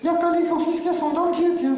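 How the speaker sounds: high, confident puppet voice; cheeky puppet. Acted. Puppet solving the boy's problem, high puppet voice. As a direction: answer as a know-it-all dummy, high-pitched.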